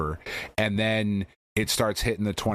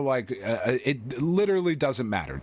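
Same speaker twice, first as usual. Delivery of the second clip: usual speaking voice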